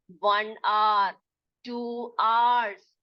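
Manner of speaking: slow one आवर, two आवर्स with no h; आवर and आवर्स as drawn-out "aar, aars"; correct pronunciation. Shown as a demonstration. Teacher showing how to tell time, correct pronunciation. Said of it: "ऐसे बोलेंगे".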